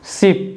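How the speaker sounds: शिप said with a quick, short इ; शिप with a brief short i sound; short i in ship. Shown as a demonstration. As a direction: clip the vowel in शिप short. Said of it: "शिप का मतलब होता है जहाज".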